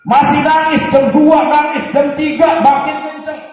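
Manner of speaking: loud, high, rising telling of the crying; building retold crying scene. Retelling a baby crying for hours.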